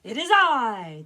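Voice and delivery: loud, slow, proud big goat; announcing big goat. Acted. Biggest goat announces himself to the troll, loud. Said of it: "the biggest billy goat announced".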